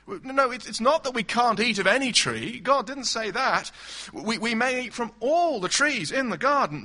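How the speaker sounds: Eve insisting. Acted. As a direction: correct him firmly as Eve. Preacher voices Eve correcting.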